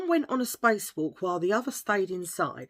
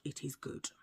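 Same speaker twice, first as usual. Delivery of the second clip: 1 whispered aside; 4 semitones lower, 14 dB quieter than usual. Real, not acted.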